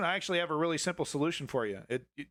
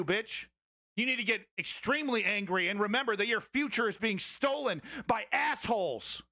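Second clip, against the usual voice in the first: higher, heated, swearing tirade building to a shout; furious rant; 5 semitones higher than usual. Real, not acted. Streamer rousing his chat against billionaires, furious. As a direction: rile the audience up.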